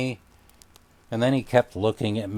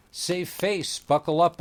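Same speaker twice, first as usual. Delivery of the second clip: stuttered; 8 semitones higher than usual. A narrator's reading. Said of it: "I stuttered, trying to sound cool".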